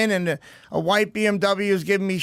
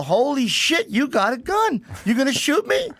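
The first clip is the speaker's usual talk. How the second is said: higher, mock-stunned cry at the gun; retold alarmed disbelief; 6 semitones higher than usual. Telling friends about the driver showing a gun, retold shocked disbelief. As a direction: relive the shock of seeing the gun. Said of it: "And I go".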